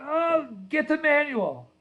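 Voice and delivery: high, slow mock wail; tearful toddler. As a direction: wail it like a crying two-year-old, drawn out. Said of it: "while he's in tears".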